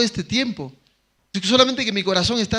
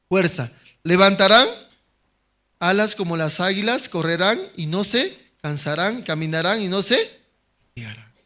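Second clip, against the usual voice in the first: lectura proclamada; 5 dB louder than usual. Acted. Reading Isaiah aloud in preaching. (lectura bíblica proclamada con fuerza)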